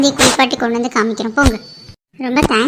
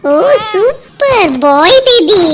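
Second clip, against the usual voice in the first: slow, higher, louder joyful news; delighted announcement; 6 semitones higher, 5 dB louder, much slower than usual. Acted. Doctor announcing a baby boy, slow and delighted. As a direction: savour the happy news slowly.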